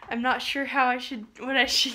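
ordinary talk, nothing marked

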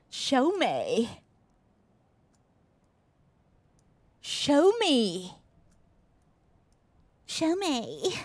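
stuck-up English Show me; mock-snooty English accent; posh English. Shown as a demonstration. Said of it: "Sehr englisch und eingebildet".

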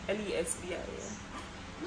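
slow unvoiced letters; whispered spelling. Shown as a demonstration. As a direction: whisper each letter, slowly. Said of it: "Read my lips".

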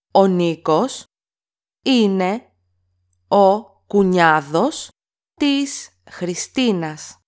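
each word alone with pauses between; slow word-by-word repeat. Shown as a demonstration. Teacher to learners, slow word-by-word model. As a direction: break it up word by word so learners can copy. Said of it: "teacher repeats word by word".

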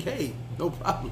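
soft, slow and easygoing, imitating the attendant; lightly voiced relaxed crew guy, no fuss; laid-back flight attendant. Flight attendant offering another vodka, calm and soft.

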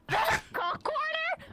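slow, high, laughing disbelief; incredulous. Real, not acted. Friend echoing the odd phrase, laughing in high disbelief. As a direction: stretch it out in amused disbelief.

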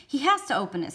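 sharp, loud insistence; the warden forcing the issue; insistent warden. A narrator's reading. Warden to the lawyer, loud and insistent. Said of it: "She was nearly hysterical".

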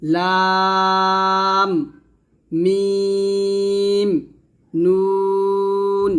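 each letter name held long and even; drawn-out letter name. Shown as a demonstration. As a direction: chant the Arabic letter names slowly, stretched. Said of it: "দেখুন এখানে". (আরবি হরফের নাম টেনে টেনে উচ্চারণ)

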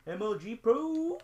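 hype. Pretend. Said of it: "gamer boast".

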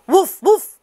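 high, loud warning call; alarmed cry. A narrator's reading. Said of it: "he cried".

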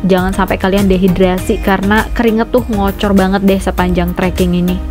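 ordinary talk, nothing marked

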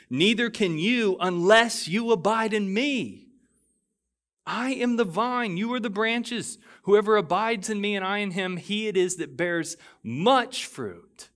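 fervent pulpit reading of scripture; impassioned scripture reading. Acted. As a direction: proclaim the words of Jesus with building force. Reads scripture at rising force.

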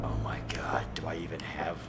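unvoiced whisper under the breath; hushed panicked whisper. Real, not acted. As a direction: whisper it to yourself. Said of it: "whispered aside".

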